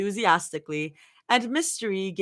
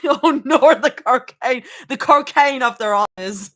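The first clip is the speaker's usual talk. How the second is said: loud, hammed-up Australian vowels; Australian accent; 2 semitones higher, 8 dB louder than usual. Shown as a demonstration. Streamer practising a silly phrase, exaggerated Australian accent. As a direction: overdo the Australian accent, on the edge of laughter. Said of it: "Guys, Australian is fucking hard".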